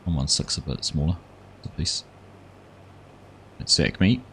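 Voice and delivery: ordinary talk, nothing marked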